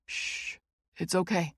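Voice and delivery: whispered shush, then soft reassurance; soothing hush. A narrator's reading. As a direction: hush her like a scared friend. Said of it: "Jake soothes".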